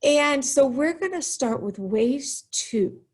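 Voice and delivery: normal talking voice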